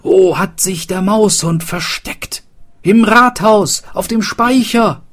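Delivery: high, loud frantic calls; frantic search. Acted. Townsfolk search for the Maushund, frantic. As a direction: shout it in a frightened rush.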